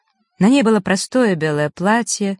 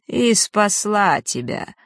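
slow, through clenched teeth; 4 semitones higher, slower than usual. Acted. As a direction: spit it out slowly. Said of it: "процедила".

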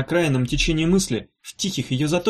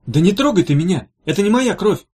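sharp, higher, louder outburst; angry; 5 semitones higher, 4 dB louder than usual. A narrator's reading. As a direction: shove her off with your voice. Wounded Valkyrie pushes her off.

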